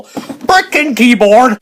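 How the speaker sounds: loud, high, drawn-out snap of irritation; angry outburst. Real, not acted. Vlogger cursing his keyboard mid-rant, loud irritation. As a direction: burst out at the thing in your way.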